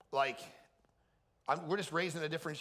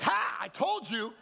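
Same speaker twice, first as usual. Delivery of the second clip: gloating; 7 semitones higher, 6 dB louder, slower than usual. Retold or imitated. Dad gloating.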